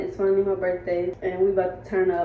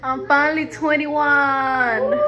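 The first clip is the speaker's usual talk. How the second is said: slow, drawn-out, higher celebratory shout; excited birthday shout; 7 semitones higher, 5 dB louder, much slower than usual. Real, not acted. Birthday girl shouting to her family at the party, excited. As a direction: yell it out to the whole room like you just made it.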